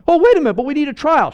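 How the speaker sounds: high, loud, fast protest; objecting councillor. Acted. Nicodemus protesting to the council, high and fast. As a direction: blurt out a protest.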